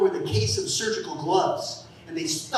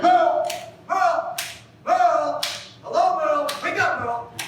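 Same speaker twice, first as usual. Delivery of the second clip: waking him up; 8 semitones higher than usual. Retold or imitated. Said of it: "I was like".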